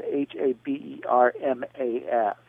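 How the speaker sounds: H A B E R M A S, each letter said separately; spelled out. Shown as a demonstration. Host giving listeners the spelling of an author's name, letter by letter. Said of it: "but spelled".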